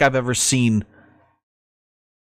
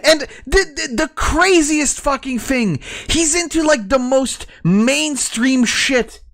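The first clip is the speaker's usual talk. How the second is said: much higher pitched, worked-up ranting; incredulous rant; 10 semitones higher than usual. Real, not acted. Streamer ranting about the mainstream fandoms, high incredulous rant. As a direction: spit it out in disbelief.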